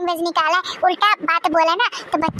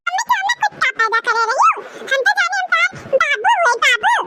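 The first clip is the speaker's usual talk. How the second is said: put-on child voice; 10 semitones higher than usual. Son talks back to mother.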